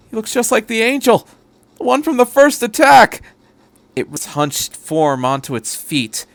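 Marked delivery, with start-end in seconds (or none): 0.1-3.2 much higher, louder frightened cry; panicked. A narrator's reading. Sato recognizes the angel, rising panicked cry. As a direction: panic as the horror sinks in.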